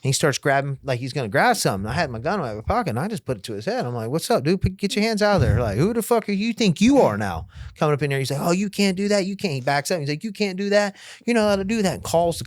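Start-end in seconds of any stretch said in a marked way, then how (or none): 4.1-8.2 raised-pitch standoff demand; retold confrontational challenge. Acted gun standoff challenge. 8.4-9.5 high-pitched imitated objection; mock protest. Imitates man backing off, protesting. 10.2-12.0 high-pitched imitated whining objection; mock protest. Imitates man backing off, protesting.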